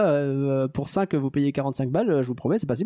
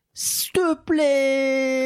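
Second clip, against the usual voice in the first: drawn-out plea; 9 semitones higher, 3 dB louder, much slower than usual. Acted. Begging listeners to share podcast.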